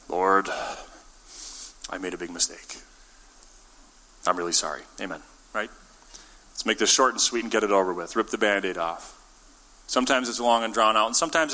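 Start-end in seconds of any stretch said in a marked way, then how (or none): 0.1-5.2 low, softened apology to God; remorseful prayer. Acted. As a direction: confess it quietly, heavy with regret. Imagined speech of remorseful prayer.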